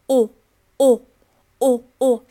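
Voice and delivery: "哦" with tense rounded lips, loud; "哦" like bel canto, round and tense; rounded o sound. Shown as a demonstration. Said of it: "而是聚拢成一个圆形". ("哦"圆唇紧张地念)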